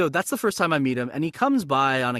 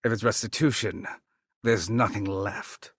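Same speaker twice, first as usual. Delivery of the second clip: Shang Tsung voice; 3 semitones lower than usual. Acted. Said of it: "Shang Tsung line".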